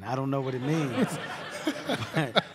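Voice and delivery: words spilling out through laughter; laughing while quoting. Retold or imitated. Laughing as he retells begging mom for rent. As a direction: laugh through your own panicked words.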